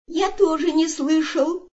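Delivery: high-pitched little kangaroo voice; sleepy mumbling child. A narrator's reading. Baby kangaroo pipes up from mother's pouch, sleepy mumble. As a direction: pipe up high, half awake. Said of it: "промямлил с просонок из маминой сумки".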